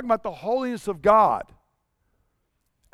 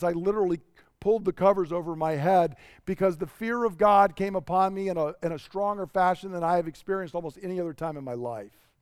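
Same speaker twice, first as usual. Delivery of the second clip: ordinary talk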